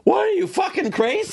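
high-pitched quoted disbelief; acted-out outrage, pitch way up; mock incredulous outburst. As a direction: blurt it out high, imitating someone aghast. Quoting outraged listeners' reaction.

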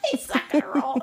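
talking through laughter; laughing. Real, not acted. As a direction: say it while laughing. Laughing at the snorer.

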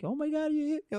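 high, soft put-on wail; mock crying. Storyteller imitating his girlfriend after the slap, mock crying. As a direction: imitate her whimpering in a high, small voice. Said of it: "she started crying and she started fucking yelling".

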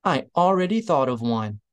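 lightly called out in character; understated calling voice; mild call. A narrator's reading. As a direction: call it back without full force. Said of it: "Platt called".

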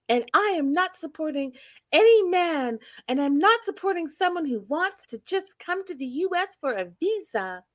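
high-pitched, indignant mock complaint; Debbie impression. Acting out a woman accusing Osama of lying, high indignant voice. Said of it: "she was like".

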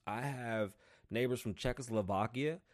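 usual speaking voice

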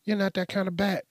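high-pitched retold retort; objecting drug dealers. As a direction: pitch it up, arguing back. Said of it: "And they're like".

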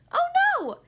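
high, loud startled oh no; dismayed cry. Acted. Monkeys learn wrong day.

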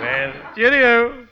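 slow, high drawn-out cheerio; ghoulish farewell. Acted. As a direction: stretch it eerily. Undertaker's farewell catchphrase.

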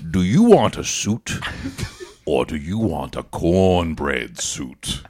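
slow, put-on tailor voice, dragging the second question; hammed-up tailor making a grand offer; mock tailor pitching a cornbread suit, slowly; tailor impression. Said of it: "he says, he says".

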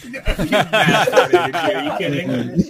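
laughing through the words; slow, laughing and incredulous; laughing disbelief. Real, not acted.

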